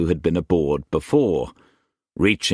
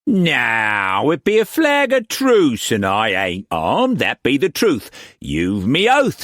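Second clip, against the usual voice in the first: high, loud pirate swearing innocence; innocent pirate; 7 semitones higher, 3 dB louder than usual. A narrator's reading. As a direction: play the picture of innocence. Pirate figure voice.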